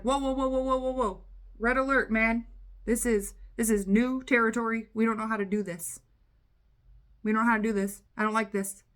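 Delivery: loud, urgent halt; mock-panicked protector putting the brakes on; alarmed subconscious mind. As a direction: sound the alarm, then urge a retreat. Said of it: "what your subconscious mind is trying".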